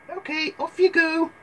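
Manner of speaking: very high sing-out mother voice; high, light mother send-off; Red's mother. Acted. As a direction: send the child off in a put-on high voice. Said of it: "Her mother was packing".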